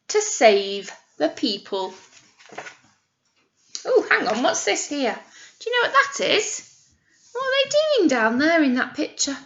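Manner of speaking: ordinary delivery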